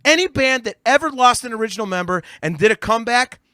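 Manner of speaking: loud, high, emphatic condemnation; real exasperated tirade, hammering the insults; furious rant. Real, not acted. Furious rant trashing the record.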